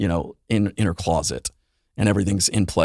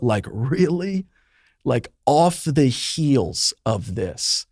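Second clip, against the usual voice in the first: higher, slow, anguished questioning; devastated disbelief; 5 semitones higher, much slower than usual. Real, not acted. Asking God why after the loss, slow anguish. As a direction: ask it as if you still can't believe it. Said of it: "feeling devastated and broken".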